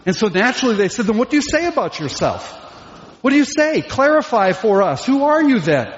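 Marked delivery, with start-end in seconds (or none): 1.0-5.9 higher, insistent questioning; pressing questions. Acted. Questioners demand John explain who he is, insistent. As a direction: press for a straight answer.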